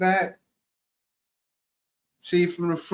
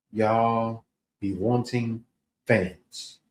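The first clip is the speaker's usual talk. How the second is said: quoting the man; 7 semitones lower, 3 dB quieter, much slower than usual. Retold or imitated. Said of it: "As the man said".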